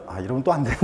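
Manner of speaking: chuckling through a mock remark; laughing quote. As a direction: let a laugh break into the quoted line. 남들 반응을 흉내 내며 웃음. (웃음 섞어 흉내 내는 말투)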